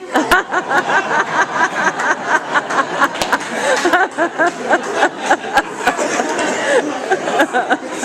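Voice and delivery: loud, high, helpless laughter; long high laugh. Real, not acted. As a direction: burst out laughing for a long time.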